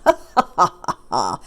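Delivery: loud staccato "ha ha" cackle; character laugh. Acted. Storyteller acting a character's laugh, showy cackle.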